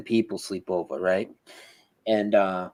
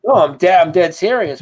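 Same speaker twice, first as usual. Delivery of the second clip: louder and higher, pressing that it's true; insistent; 6 semitones higher, 6 dB louder than usual. Retold or imitated. Telling the listener he is dead serious, louder and higher. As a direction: insist hard, raising the voice. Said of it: "I'm like".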